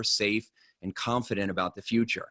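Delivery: ordinary talk, nothing marked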